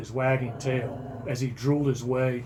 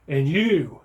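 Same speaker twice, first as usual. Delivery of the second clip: loud, raised call-out; yelled; 3 semitones higher, 7 dB louder than usual. A narrator's reading. Owner turning to yell at the cat, loud call-out. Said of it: "I yelled".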